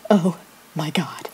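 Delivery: soft, low, stunned murmur; retold stunned dismay. Teller reading the crush's rejection, quietly stunned.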